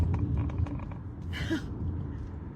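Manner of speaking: quiet laugh at herself; soft breathy laugh. Real, not acted. Preacher laughing at her own good point, soft chuckle. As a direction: chuckle softly, pleased.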